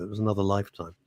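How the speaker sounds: usual speaking voice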